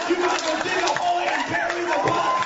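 usual speaking voice

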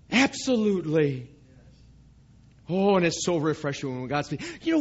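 ordinary talk, nothing marked

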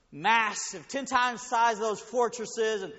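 loud, rising sermon build-up; preaching at full force. Acted. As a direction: preach it big, like the walls are shaking. Preaching builds to fortress image.

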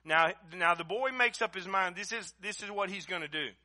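loud, high, impassioned preacher's cry; fiery preaching. Acted. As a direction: preach it at full force, pleading. Preaching at full force.

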